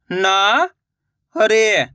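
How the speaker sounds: नारे drawn out slowly, syllable by syllable; slow syllable split. Shown as a demonstration. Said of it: "मैं किस तरीके से".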